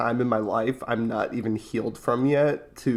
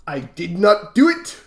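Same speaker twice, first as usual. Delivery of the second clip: put-on loud, higher, slow indignant Tyrion accent; Tyrion impression; 8 semitones higher, 8 dB louder than usual. Brother showing off his Tyrion, loud put-on accent. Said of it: "you Tyrion".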